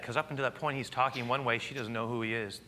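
ordinary talk

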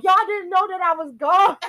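high, indignant and laughing through it; pissed-off complaint. Retold or imitated. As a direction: let them have it for forgetting you. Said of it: "I'm so pissed".

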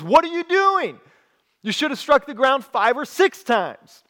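high-pitched, indignant outburst; angry. Acted. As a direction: scold in exasperated disbelief, voice jumping up. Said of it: "Elisha gets angry with the king".

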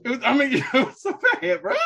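high, laughing through the words; helpless giggle breaking into the sentence; laughing in disbelief. Real, not acted. Co-host admits the album was bad, laughing.

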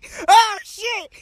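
high, loud amazed shout; excited shout. Real, not acted. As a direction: shout it out in awe. Squirrel flips.

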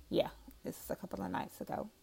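quick breathy whisper aside. Real, not acted. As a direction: whisper it to yourself. Aside remark.

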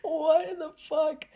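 high, loud squeal of disbelief; appalled disbelief. Retold or imitated. Appalled reaction to the anime.

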